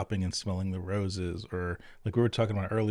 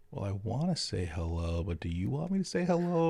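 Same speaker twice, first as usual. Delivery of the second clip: retold awkwardness; 5 semitones higher than usual. Podcast host unsure about greeting visitors during covid, mock awkward. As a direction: lightly act out awkward dithering. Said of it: "you would always have that awkward".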